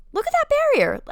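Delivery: high, loud, quick burst of excitement; excited. Real, not acted. Runner watching her own race footage, excited. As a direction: exclaim it with sudden pride.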